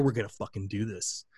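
low, soft hushed vow; retold whisper. Father to pub owner during comeback, quiet intense promise. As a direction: murmur it close to his ear. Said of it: "whispered in his ear".

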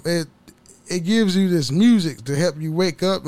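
ordinary talk

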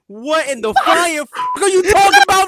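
high, rising incredulity; can't believe what he heard; outraged disbelief. Real, not acted. Outraged at hot take.

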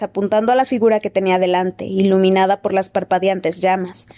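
plain reading voice

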